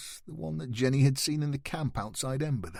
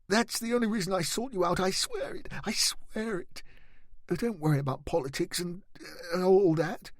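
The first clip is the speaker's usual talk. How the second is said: high, fast, frantic pleading; desperate pleading; 8 semitones higher than usual. Acted. Boy desperately pleading with the dragon-slayer to trust him. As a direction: beg him to believe you. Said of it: "pleaded with Avacyn to believe".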